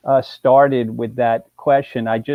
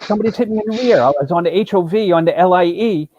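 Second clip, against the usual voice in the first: higher, faster retelling of a panicked caller; excited caller; 6 semitones higher, faster than usual. Retold or imitated. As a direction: rattle it off like a client who just got rear-ended. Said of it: "He's all excited".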